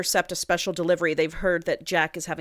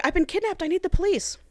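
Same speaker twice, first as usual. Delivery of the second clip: put-on very high frantic girl's voice; put-on damsel in distress, pleading for help; mock panic; 12 semitones higher than usual. Kidnapped girl runs into a stranger, mock panicked.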